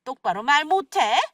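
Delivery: indignant interrogation, pitch jumping high at peaks; scolding mother-in-law. Acted. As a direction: scold them for lying to you. (거짓말을 꾸짖는 시어머니)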